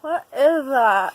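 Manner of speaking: loud, slow and appalled; disgusted shock. Real, not acted. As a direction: recoil at the taste, loud and slow. Tasting gross mystery food.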